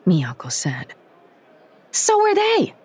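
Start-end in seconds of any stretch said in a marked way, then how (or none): 1.9-2.7 high angry yell. A narrator's reading. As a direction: snap back in a furious shout. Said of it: "Emma yelled".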